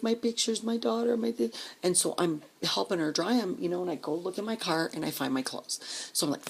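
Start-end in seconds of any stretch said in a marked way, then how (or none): 0.0-1.5 imitated distress. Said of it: "and she's crying".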